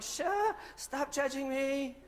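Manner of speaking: high, then slow, drawn-out whine; mock protest. Husband retorting to nagging wife, high slow whine. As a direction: plead back, stretching the words. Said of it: "I'm like".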